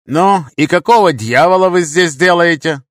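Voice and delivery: loud, high interrogation; angry demand. Acted. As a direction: demand an answer, leaning on the words. Interrogating the captured man.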